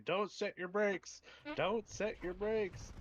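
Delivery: high, slow mock yell of warning; retold yell. Truck stop guy yelling at a driver with hot brakes, a slow mock yell. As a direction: call it out like a trucker waving you down. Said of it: "he's screaming at me".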